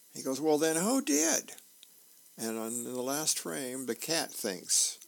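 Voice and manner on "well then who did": high-pitched challenge, rising sharply; exasperated retort. Acted. Husband demands who took his socks, exasperated. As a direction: throw the question back, pitched way up. Acted comic quarrel over socks.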